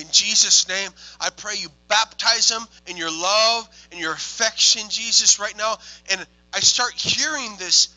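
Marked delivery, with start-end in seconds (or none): 0.0-5.8 loud, raised, urgent praying; impassioned retold prayer; fervent prayer. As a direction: pray it out with force. Retells praying over the man.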